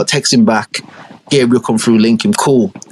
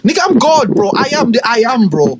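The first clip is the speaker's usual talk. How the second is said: high, swaggering boast; boastful God; 12 semitones higher than usual. Acted. God bragging to Gabriel, high and boastful. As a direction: brag loftily about your own might.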